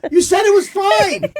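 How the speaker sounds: a grown man whining in outrage; furious whine. Retold or imitated. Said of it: "Now I'm furious".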